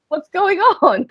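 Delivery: high, puzzled question; confused, half-laughing; baffled. Retold or imitated. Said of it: "you're like".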